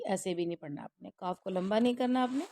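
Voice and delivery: normal talking voice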